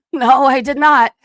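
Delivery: loud, firm retort; retold indignant denial; emphatic denial.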